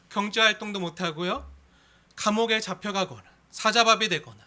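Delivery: normal talking voice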